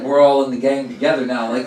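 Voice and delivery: ordinary delivery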